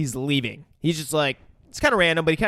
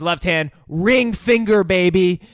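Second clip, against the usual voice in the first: higher, louder, drawn-out delivery; relishing announcement; 3 semitones higher, 6 dB louder, slower than usual. Retold or imitated. Woman naming which finger gets cut, relishing call. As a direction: stretch out each word with relish. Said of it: "And she says".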